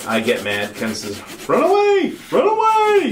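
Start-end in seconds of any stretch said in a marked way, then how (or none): 1.5-3.1 high, loud panicked cry; squealing retreat in high panic; Monty Python run away. Retold or imitated. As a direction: shriek it like a fleeing knight. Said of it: "Ken does a Monty Python".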